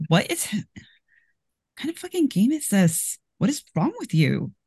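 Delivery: soaring high pitch of appalled disbelief; can't believe this game exists; horrified disbelief. Acted. [name] yelling at the players, high and horrified.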